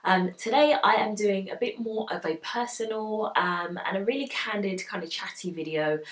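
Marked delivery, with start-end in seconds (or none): none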